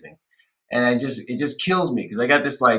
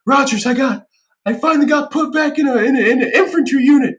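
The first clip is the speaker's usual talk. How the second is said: high, loud, proud voice of a tipsy Marine; hammed-up drunk boasting; drunk excited recruiter; 13 semitones higher, 6 dB louder than usual. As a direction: brag like a giddy drunk.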